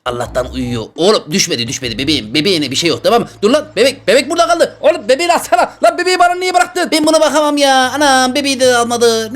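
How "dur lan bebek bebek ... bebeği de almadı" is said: flustered. Real, not acted. Flustered, left holding the baby.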